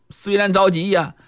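usual speaking voice

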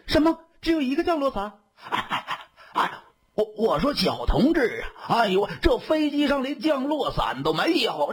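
high-pitched, stammering panic; dumbfounded. A narrator's reading. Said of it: "这下懵了". (懵了，高声惊慌地结巴)

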